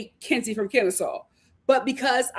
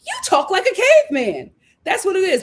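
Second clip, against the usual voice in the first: much higher and louder, thrilled; excited realization; 8 semitones higher, 5 dB louder than usual. Real, not acted. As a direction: blurt it out delighted. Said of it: "something just hit me".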